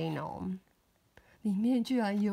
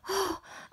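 sharp gasp; 9 semitones higher, 4 dB quieter than usual. Acted. Reacting to monster.

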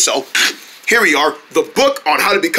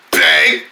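deep bang sound effect. Acted. Character slams another down.

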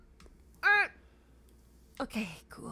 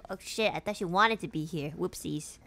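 ordinary talk

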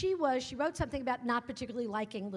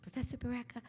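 put-on soft, quick stab at a Southern accent; classy Texas accent, half-managed; attempted Southern accent; 7 dB quieter, faster than usual. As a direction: lightly imitate an elegant Texas woman's accent. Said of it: "I can't do a Southern accent".

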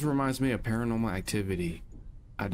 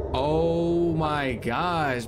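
drawn-out shock; 5 semitones higher, 4 dB louder, much slower than usual. Real, not acted. Spooked by levitating ghost.

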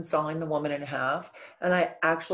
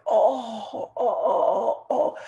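loud, pitched-up pained groans, one with a cry; imitating dad's moans; 5 semitones higher, 5 dB louder, much slower than usual.

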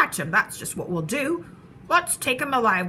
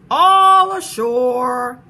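long drawn-out, booming sailor's call; big, loud shout with stretched vowels; 12 dB louder, much slower than usual. A narrator's reading. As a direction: holler it slowly across the deck. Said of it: "the men shouted".

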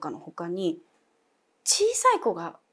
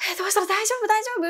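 high anxious repeated asking; worried calling; 13 semitones higher, faster than usual. Retold or imitated. Anxious friend outside the door, high worried asking. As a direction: keep asking, voice pitched up with worry.

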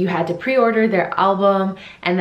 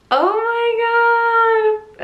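excited squeal; much slower than usual. Real, not acted. Reacting to video, squealing.

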